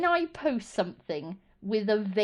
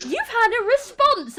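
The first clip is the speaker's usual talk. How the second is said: much higher, louder sing-song alert; mock notification voice; 12 semitones higher, 5 dB louder than usual. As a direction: chirp it like a phone alert. Mimicking notification.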